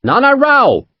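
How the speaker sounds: high, loud call across the courtyard. A narrator's reading. Captain calling to the man hiding in the porch, loud call. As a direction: call him out of the shadows.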